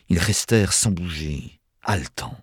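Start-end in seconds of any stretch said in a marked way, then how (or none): none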